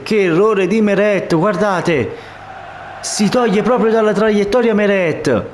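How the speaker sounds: high, shocked goal shout; stunned commentator yelling the equaliser; breathless italian goal commentary. Real, not acted.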